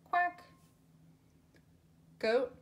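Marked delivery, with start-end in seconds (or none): 0.0-0.4 squeaky loud quack; high quack. Pretend. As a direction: quack like a duck. Listing animal sounds for kids.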